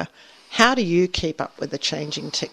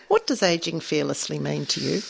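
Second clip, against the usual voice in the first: ordinary talk, nothing marked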